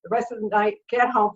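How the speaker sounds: usual speaking voice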